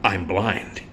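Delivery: low, soft dramatic reveal; mock dramatic reveal. Blind husband revealing his blindness to rescuers, hushed and low. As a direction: deliver it as a hushed big revelation. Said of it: "he goes".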